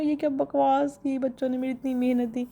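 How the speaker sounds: retold outburst at the children over deleted videos; mock angry scolding. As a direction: scold the kids as if it just happened. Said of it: "मुझे इतना कोई गुस्सा आया".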